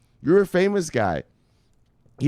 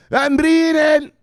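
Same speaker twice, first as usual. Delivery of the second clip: drawn-out name call; 7 semitones higher, 6 dB louder, slower than usual. Acted.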